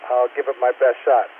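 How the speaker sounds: normal talking voice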